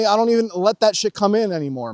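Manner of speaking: usual speaking voice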